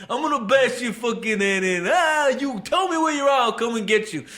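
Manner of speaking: high, threatening wise-guy caller. Retold or imitated. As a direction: imitate a ranting mobster on the phone. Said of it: "all these other wise guys call".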